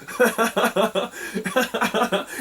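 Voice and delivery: long, high peal of laughter; real helpless laugh; laughing fit. Real, not acted. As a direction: crack up completely. Laughing at his own story.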